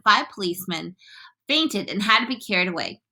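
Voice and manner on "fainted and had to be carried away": ordinary delivery, nothing marked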